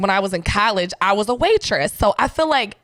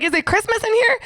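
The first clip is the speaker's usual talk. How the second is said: much higher, incredulous; mock amazement; 12 semitones higher than usual. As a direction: pretend to be amazed. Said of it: "I was like".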